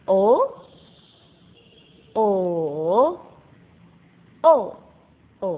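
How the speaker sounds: "O" rising, then dipping, then falling, then light; four tones on O. Shown as a demonstration. Teacher running through the tones on O for pupils to repeat. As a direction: run "O" through the tones in turn. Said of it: "Ikutin ya".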